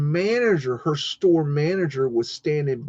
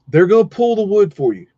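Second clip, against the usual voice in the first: imitating the boss; 7 semitones higher, 7 dB louder than usual. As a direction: boss line, higher and louder. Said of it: "He's like".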